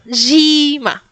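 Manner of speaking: Gima as smiling ZHEE-ma, long i. Shown as a demonstration. Teacher telling students to smile, bright spread vowel. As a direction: smile through a drawn-out Gima.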